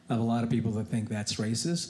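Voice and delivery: normal talking voice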